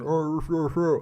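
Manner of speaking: garbled mouthful noises; mushy, wordless muffled speech; fake-teeth mumble. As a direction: imitate barely intelligible talk through fake teeth. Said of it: "I've got fake teeth in, so you can barely make out".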